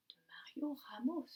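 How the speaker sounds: whispered name, slow and secretive; hushed teacher whisper. Real, not acted.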